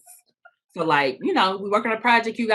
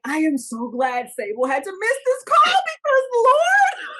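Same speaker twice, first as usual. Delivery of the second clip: exasperated; 13 semitones higher, 3 dB louder than usual. Retold or imitated. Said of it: "that I'm like".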